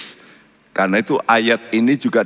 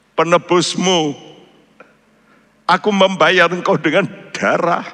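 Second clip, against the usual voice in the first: God's voice; 7 semitones higher than usual. Acted. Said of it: "Tuhan berkata dalam hati saya".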